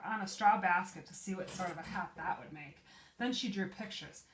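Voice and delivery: ordinary delivery, nothing marked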